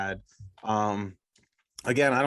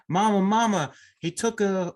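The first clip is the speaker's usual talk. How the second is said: higher, louder call to mama; alarmed young bride; 8 semitones higher, 4 dB louder, slower than usual. Acted. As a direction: play a flustered bride running to her mother. Bride runs back to her mother.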